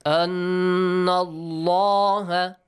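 Anna Allah chanted very slowly, dark heavy L in Allah; heavy lam. Shown as a demonstration. Teacher showing the heavy sound, Anna Allah with dark L, drawn out. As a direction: hold the n of Anna, then a full, heavy Allah. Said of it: "with a heavy sound".

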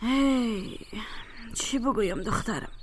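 a whispered, resigned aside; mother whispering her disappointment to her daughter; whispered sigh. Acted. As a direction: breathe it out, hopeless. Mother sighs over absent daughter.

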